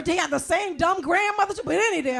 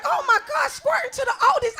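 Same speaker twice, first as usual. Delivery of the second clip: much higher, laughing shout; shocked shriek; 8 semitones higher than usual. Retold or imitated. Daughter shocked by her mother, high laughing shriek. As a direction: squeal in disbelief. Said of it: "I was like".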